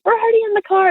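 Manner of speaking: high-pitched mock protest; acted protest. Family's acted protest to dad.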